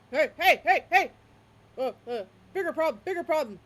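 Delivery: loud, high, repeated barky bursts; bulldog barking alarm. Acted. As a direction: bark out the alarm like an agitated bulldog. Bulldog barks an urgent alarm.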